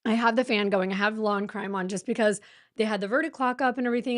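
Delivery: ordinary talk, nothing marked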